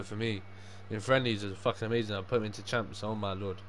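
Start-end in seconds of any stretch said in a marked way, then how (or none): none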